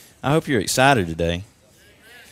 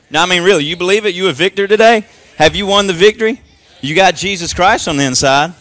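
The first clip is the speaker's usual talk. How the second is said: higher, louder rallying questions; rousing preacher; 7 semitones higher, 5 dB louder than usual. Acted. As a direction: fire the church up. Rallying congregation about victory.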